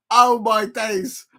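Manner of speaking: slow, drawn-out exasperation; amused exasperation at the mix-up; exasperated. Real, not acted. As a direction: stretch it out in disbelief. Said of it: "exasperated exclamation".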